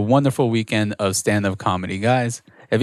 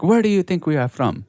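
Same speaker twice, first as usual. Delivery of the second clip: higher-pitched mock South African accent; imitated South African accent; 7 semitones higher than usual. South African couple questioning the security guard, imitated South African accent. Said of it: "I just knew that they had an accent".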